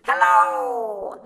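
high, drawn-out call across space; shouted greeting. A narrator's reading. Shiny object passing Sinclair at speed, shouted long and high. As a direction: call it out slowly, stretching the last word. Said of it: "it shouted".

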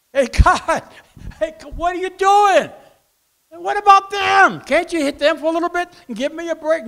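high, loud protesting plea; hammed-up complaint to God. Sufferer asking God to hit others instead, high comic protest. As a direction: wail up at God in mock protest.

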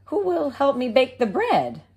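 high, bright hen voice; little red hen. Acted. The hen asking neighbours about seeds, in a high voice. Hen's voice.

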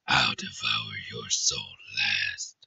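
very low, slow, whispery menace; dark, drawn-out soul-eater voice; menacing creature voice. Acted. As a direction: growl it softly from the very bottom of your range. Voicing a soul-devouring game character.

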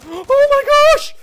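shocked gasp that jumps way up; gasping oh my gosh. Real, not acted. Gamer gasps at the screen in shock. Sudden gasp during gameplay intro.

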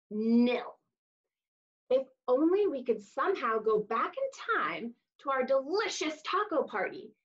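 lively storybook voice for little listeners; reading to children. Acted. Teacher reading a taco story to kids, animated storybook voice. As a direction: read "hola" book style, animated and bright. Reads picture book to children.

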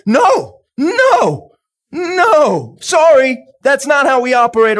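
firm refusal. Retold or imitated. Preacher voicing an anointed believer refusing sickness, loud. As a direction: shout it down with authority. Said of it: "it takes somebody that's like".

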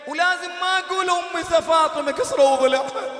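loud, impassioned preaching, almost shouting; preaching at full force. Acted. Preacher to the mourners about Fatima's rib, shouting passionately. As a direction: rail at the crowd with rising outrage.

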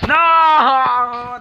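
loud, high drawn-out "no"; real dismayed yell. Real, not acted. Gamer reacting to a setback mid-game, dismayed yell.

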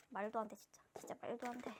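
hushed disbelief, almost no voice; soft, breath-only murmur; stunned whisper. Real, not acted. Disbelief at lucky pulls.

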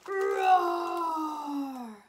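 loud drawn-out roar; grumpy bear roar. Acted. Grumpy bear Bruce roars at the goslings, loud. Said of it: "He became extra grumpy with them".

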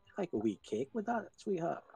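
Scottish woman. Retold or imitated. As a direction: mimic the Scottish tea lady offering cake. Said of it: "this woman's just like".

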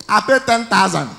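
ordinary talk, nothing marked

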